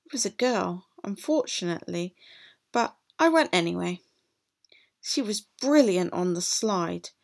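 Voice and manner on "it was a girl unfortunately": usual speaking voice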